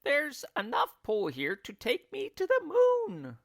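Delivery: put-on high, excited old woman's call; a hint of giddy excitement; crying out. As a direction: call out, thrilled by the pull. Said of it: "Missus Twit cried out".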